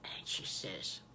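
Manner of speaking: hushed suspense whisper. A narrator's reading. Grandma telling the children the search, whispered low.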